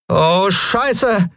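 high-pitched curse with a falling end; Lien's high dismayed curse. Acted. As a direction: groan it out in dismay. Lien sees attackers coming.

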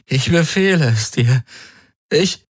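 high, helpless attempt at command; whimpering. A narrator's reading. Galen orders the Helix while shaking with pain, whimpering. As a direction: give the order in a rising whimper. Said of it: "wimmerte er".

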